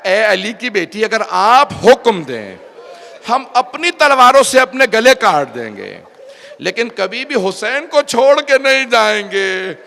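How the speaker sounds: loud, rising oath of loyalty; impassioned retold pledge. Companions pledging loyalty to Ali's daughter, loud and impassioned. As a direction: declaim it as a fervent vow, drawing out the words.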